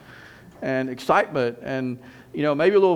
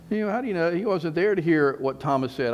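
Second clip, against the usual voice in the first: ordinary talk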